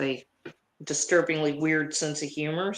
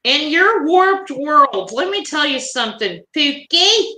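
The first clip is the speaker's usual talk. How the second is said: sassy scolding; 9 semitones higher, 5 dB louder than usual. Acted. A woman scolds the man calling him Pookie, high sassy lecture. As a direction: put him in his place, sing-song.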